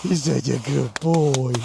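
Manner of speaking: gentle slow praise for the dog. Real, not acted.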